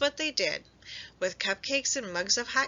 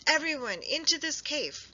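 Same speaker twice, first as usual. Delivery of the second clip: much higher, urgent order; 8 semitones higher than usual. A narrator's reading. Badger ordering friends into cave, urgent. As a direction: hurry everyone to safety. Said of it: "yelled Badger".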